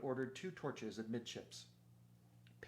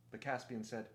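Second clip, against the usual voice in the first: plain reading voice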